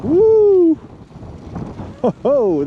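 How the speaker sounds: high, loud whoop in a gust; gasping in icy wind. Real, not acted. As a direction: yelp as the gust hits. Hit by a strong gust.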